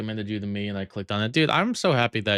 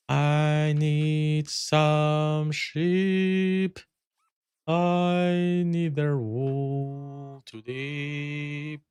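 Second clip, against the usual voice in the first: silly drawn-out voice; 6 semitones higher, much slower than usual. Pretend. Slow put-on voice to sheep.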